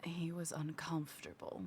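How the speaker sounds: hushed conspiratorial whisper. Acted. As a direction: whisper it like a sneaky rogue. Rogue whispers in character.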